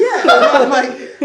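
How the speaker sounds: loud slow laugh through the words. Real, not acted. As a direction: laugh it out, drawn out. Laughing at his own story.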